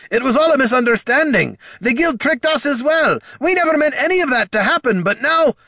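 high, loud dwarf plea; desperate shouting. A narrator's reading. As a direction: shout explanations with rising desperation. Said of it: "The dwarf kept shouting, hints of desperation".

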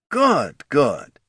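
high, punchy executive voice; eager head of marketing, approving. Acted. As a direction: approve brightly like a keen marketing boss.

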